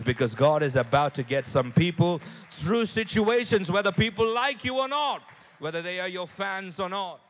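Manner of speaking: loud, rising preacher's call; building preaching. Acted. A pastor rousing the church to cheer, loud preaching. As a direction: lift the congregation into praise.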